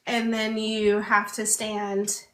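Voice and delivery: ordinary talk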